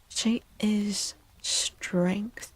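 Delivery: ordinary talk, nothing marked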